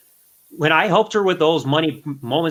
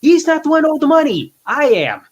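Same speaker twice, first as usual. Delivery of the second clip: high, loud mock protest; a raised, indignant inner voice; indignant thought; 10 semitones higher, 4 dB louder than usual. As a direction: protest like you're the one being cheated. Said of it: "I'm sitting there thinking".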